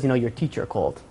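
low, soft voice of a father; hushed, acted parental menace; ominously quiet father. Father confronts the boy, menacingly calm.

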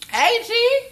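high, loud greeting; excited call. Acted. Greeting Joe, high excited call. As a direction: call out hyped.

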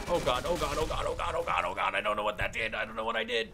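loud, rapid string of alarm; real panicked outburst. Real, not acted. Gamer unsure what they just set off, loud panic. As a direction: blurt it over and over in alarm. Startled by unknown result in game.

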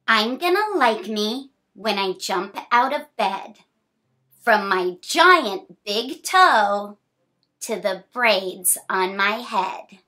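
bright, sing-song picture-book reading; reading to children. A narrator's reading. Reading a picture book to a child, sing-song. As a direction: read the rhyme cheerfully to a small child.

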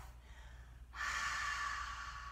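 a soft, voiceless out-breath; just breathe the ha out; breathy exhale. Shown as a demonstration. Teacher shows the gentler fire version, soft breath. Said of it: "more of a breath".

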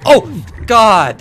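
sudden high, loud yelp; real jump-scare cry; startled scare. Real, not acted. Player startled by a sea creature, frightened yelp.

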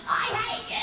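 high-pitched screaming tantrum; child's tantrum. Retold or imitated. Comic as a child on the supermarket floor, screaming tantrum. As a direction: shriek it like a child in meltdown. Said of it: "child position's actually on your back in a supermarket going".

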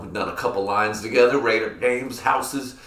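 usual speaking voice

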